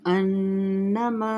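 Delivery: slow melodic tajweed chant; Quran recitation. Shown as a demonstration. Recites with ghunna.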